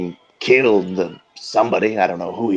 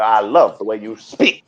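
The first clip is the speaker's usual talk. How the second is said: usual speaking voice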